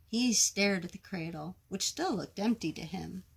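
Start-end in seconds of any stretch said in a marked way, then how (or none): none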